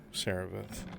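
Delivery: normal talking voice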